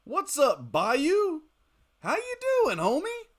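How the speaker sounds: high, loud shout-out to a friend; excited greeting. Real, not acted. Streamer greeting a viewer in chat, loud and excited. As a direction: call out happily to a familiar face.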